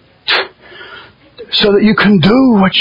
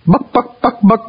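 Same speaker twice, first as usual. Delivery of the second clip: higher playful chicken imitation, laughing; chicken clucking; 5 semitones higher than usual.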